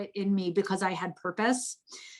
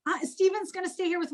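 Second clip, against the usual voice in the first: much higher mock voice of her father; imitating dad; 10 semitones higher, faster than usual. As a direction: mimic dad playing it down with a high mock voice. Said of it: "he goes".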